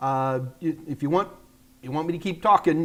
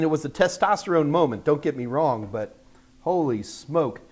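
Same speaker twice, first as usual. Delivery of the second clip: ordinary talk, nothing marked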